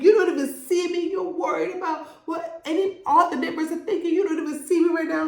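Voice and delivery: high, fast, hammed-up pleading; breathless mock drama, high and quick; overacted wife's plea. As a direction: ham up the hurt wife's rush of complaints. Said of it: "great acting".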